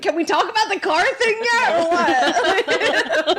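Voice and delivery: high, quick laughing demand; laughing blurt. Retold or imitated. Blurting what she held back, laughing.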